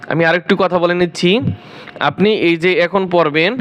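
normal talking voice

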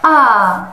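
loud, long "आ" with a falling tune; drawn-out teacher's "आ"; vowel sound demo. Shown as a demonstration. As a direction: hold "आ" out loudly, letting it fall. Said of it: "आप उसे इस तरह से सिखाएं".

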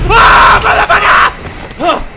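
real jump-scare shriek, high and very loud; scared scream. Real, not acted. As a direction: scream the curse in fright. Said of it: "That scared the heck out of me".

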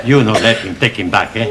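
usual speaking voice

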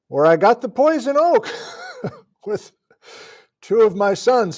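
high, loud, breaking into a laugh. Real, not acted. Streamer showing viewers the family barn, laughing. Laughs over a painful farm memory.

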